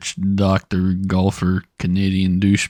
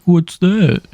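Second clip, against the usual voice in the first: mock confused; 10 semitones higher, 5 dB louder than usual. Mocking the confused cashier.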